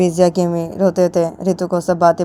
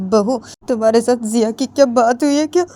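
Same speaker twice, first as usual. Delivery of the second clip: crying; 6 semitones higher, 3 dB louder than usual. Acted. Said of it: "रोते हुए तो बोलती".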